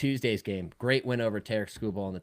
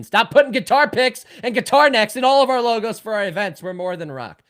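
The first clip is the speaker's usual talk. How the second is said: much higher and louder exasperated rant; 12 semitones higher, 10 dB louder than usual. Real, not acted. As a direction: vent like a fed-up local. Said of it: "you hit a nerve there".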